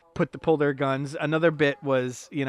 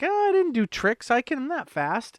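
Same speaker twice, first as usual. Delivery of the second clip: mock protest; 11 semitones higher than usual. Ned protesting to the German.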